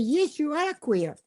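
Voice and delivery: high, slow, stubborn retort; insistent retort. Retold or imitated. Wife insisting to her upset husband, high and stubborn. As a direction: insist on it, drawing the words out. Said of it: "And I said".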